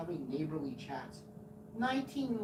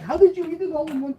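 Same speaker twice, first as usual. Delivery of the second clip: high fast neighbor voice; 12 semitones higher, 15 dB louder, faster than usual. Retold or imitated. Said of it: "She's like".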